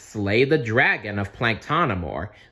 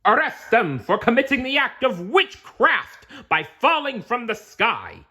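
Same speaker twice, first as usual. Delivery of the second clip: higher, loud stern command; ordering knight; 5 dB louder than usual. Acted. Knight ordering the boys' arrest, stern loud command. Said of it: "ordered one of the knights".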